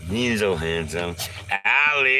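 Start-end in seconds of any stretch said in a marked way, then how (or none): 1.6-2.2 high, loud call of a name. Real, not acted. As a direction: shout the name across the yard. Calling out to the dog.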